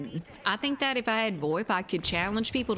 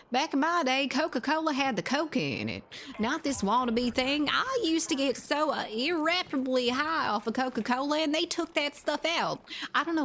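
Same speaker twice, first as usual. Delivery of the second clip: put-on southern accent; 6 semitones higher than usual. Streamer griping about old Coca-Cola in a put-on Southern accent. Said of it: "the southern accent".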